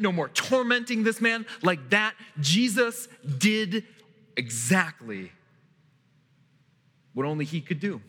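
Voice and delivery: normal talking voice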